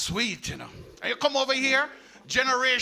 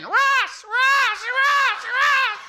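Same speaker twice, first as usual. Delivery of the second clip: very high, loud parakeet squawk; parakeet imitation; 7 dB louder, much slower than usual. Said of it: "What them saying?".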